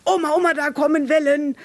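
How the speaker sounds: high, loud child calling out in pretend alarm; imitated excited grandchild. Grandchild warns Oma of waves and monsters, excited mock alarm. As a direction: cry out like a child spotting make-believe danger. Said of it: "Und dann kommt".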